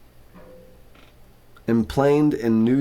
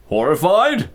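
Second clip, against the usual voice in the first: higher, louder challenge; incredulous father; 9 semitones higher, 7 dB louder than usual. A narrator's reading. Cat father doubts his son's faith in Joanna, incredulous. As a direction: build to a loud, disbelieving question.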